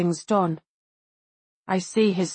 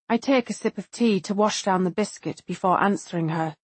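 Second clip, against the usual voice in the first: plain reading voice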